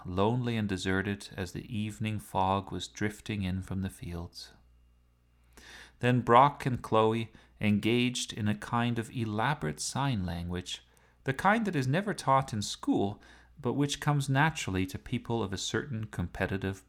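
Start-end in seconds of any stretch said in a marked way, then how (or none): none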